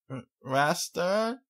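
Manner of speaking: high, slow sleepy coo; a sleepy child cooing; sleepy young filly; tired cooing. A narrator's reading. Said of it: "she cooed tiredly".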